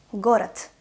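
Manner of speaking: "город" ending in a T sound; voiceless final consonant. Shown as a demonstration. Teacher shows the devoicing rule, "город" with final T. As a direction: say "город" as "gorat". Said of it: "becomes voiceless".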